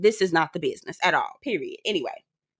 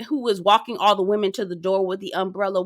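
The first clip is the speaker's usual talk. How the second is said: ordinary talk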